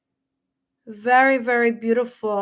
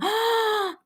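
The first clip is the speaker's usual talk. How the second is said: sharp high intake of breath; surprised gasp; 10 semitones higher than usual. Real, not acted. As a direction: gasp as the detail lands. Reacting to a confirmed detail.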